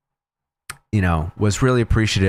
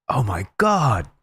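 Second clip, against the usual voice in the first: slow, drawn-out amazement; slow retold awe; awed realization; 5 semitones higher, slower than usual. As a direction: let it dawn on him slowly. Dave realizing, then hugging.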